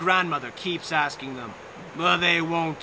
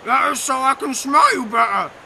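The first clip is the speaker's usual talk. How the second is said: louder put-on wolf voice; 6 semitones higher, 8 dB louder than usual. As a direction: menace her as the wolf.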